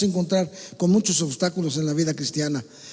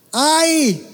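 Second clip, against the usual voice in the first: high loud "Ay!" cry; loud cry of pain; 5 semitones higher, 4 dB louder than usual. Shown as a demonstration. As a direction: cry out "Ay!" as if hurt. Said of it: "díganlo fuerte".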